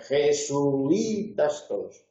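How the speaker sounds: JESUITAS very slow as je-su-I-tas; drawn-out hiatus. Shown as a demonstration. Teacher quotes Sunday homily preachers, slow hiatus je-su-I-tas. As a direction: stretch "jesuitas" syllable by syllable, u apart from i. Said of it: "pues lo pronuncien y digan".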